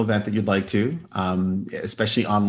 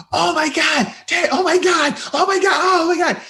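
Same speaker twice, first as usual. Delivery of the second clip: put-on much higher, thrilled child squeal; squealing kids; 3 dB louder than usual. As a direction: imitate excited kids spotting dad. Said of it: "they're like".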